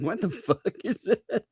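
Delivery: high, laughing retort; laughing mock disbelief. Voicing listeners baffled by the accent, laughing. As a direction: imitate bafflement through laughter.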